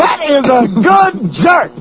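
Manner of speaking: slow, emphatic stand-up shout; Chris Rock. Acted. Host praising in the comic's style, Chris Rock impression. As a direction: hammer each word like a comedian. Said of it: "That's Chris Rock".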